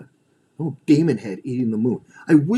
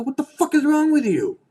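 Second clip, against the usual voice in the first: much higher, loud confrontation; confronting yell; 3 dB louder than usual. Retold or imitated. Deprogrammer yelling at a cult member, high and loud. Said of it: "sit there and go".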